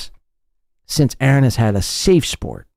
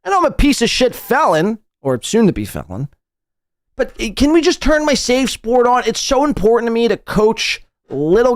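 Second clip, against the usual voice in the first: mocking whiny voice; 9 semitones higher than usual. Mocking Aaron's request, high voice.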